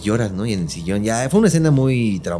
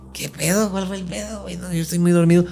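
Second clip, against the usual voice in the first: much higher, half-asleep panic; confused and startled, pitched up; groggy alarm; 10 semitones higher than usual. Retold or imitated. Just woken son asking mom what happened, alarmed. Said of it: "Y yo así como de".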